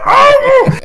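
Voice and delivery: high, loud whoop of disbelief; excited yell. Retold or imitated. Retelling his reaction to wife's pre-order, excited yell. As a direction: cry out as if you just won.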